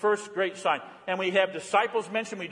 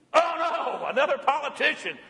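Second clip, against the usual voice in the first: higher, laughing mock dismay; mock groan; 6 semitones higher than usual. Wife groaning at the TV, amused mock dismay. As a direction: groan at yet another politician, amused. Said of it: "Obama is talking".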